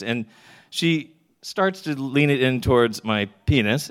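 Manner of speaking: ordinary talk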